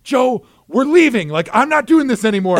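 playing his fed-up wife demanding to leave; yelling wife. Wife to husband, mock yell to leave. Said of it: "my wife starts yelling at me".

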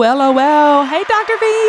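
high, bright hello to the guest; excited greeting. Real, not acted. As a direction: call out the welcome.

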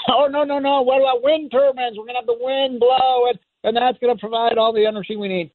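high-pitched mock protest; mocking imitation. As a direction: parody an eager wind-power fan. Mocking wind power advocates.

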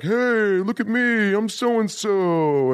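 very high, loud goofy holler; drawn-out, over-the-top comic attention-seeker; hammed-up high-pitched goofball yelling; early Farley. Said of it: "like, a early Farley".

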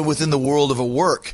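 usual speaking voice

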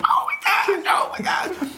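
high breathless whispered oh my God; silent excited squeal. Retold or imitated. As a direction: squeal it under your breath, phone on mute. Silent excitement on muted phone.

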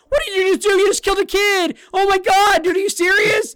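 high, loud squeaky Morty-like shriek; outraged talking gun. Retold or imitated. The talking gun scolding the player for killing a kid, high outraged shriek. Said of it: "And the gun says".

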